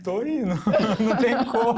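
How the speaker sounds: giggling through the words; can't stop laughing. Real, not acted. Group laughter exercise.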